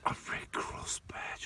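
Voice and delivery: slow hushed whisper of wonder; awed whisper. Real, not acted. Whispering in awe at his mate's Red Cross badge. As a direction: breathe it out slowly, amazed.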